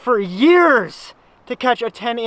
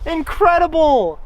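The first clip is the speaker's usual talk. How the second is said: higher, louder and fast, buzzing with joy; real elation; ecstatic cheer; 6 semitones higher, 6 dB louder than usual. Real, not acted. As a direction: rattle it off overexcited. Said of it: "Listen to my voice".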